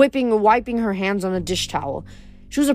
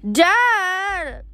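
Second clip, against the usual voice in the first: put-on high child's yell; high kid's cry for dad; little boy screaming; 10 semitones higher than usual. A narrator's reading. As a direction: shout for dad like a small boy. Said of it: "a little boy screamed".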